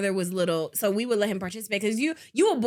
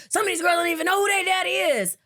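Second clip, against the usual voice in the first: higher, louder mock rant from dad; dad's mock shout; 8 semitones higher, 4 dB louder than usual. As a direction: boom it out like a dad lecturing. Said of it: "He'd be like".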